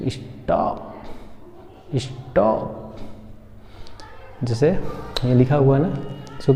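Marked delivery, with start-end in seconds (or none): 0.1-3.0 स्टॉप with an open aw, trailing softly; correct English vowel. Shown as a demonstration. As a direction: model स्टॉप between 'staap' and 'stope'. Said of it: "क्या बोलेंगे". (स्टॉप में खुला ऑ बोलें)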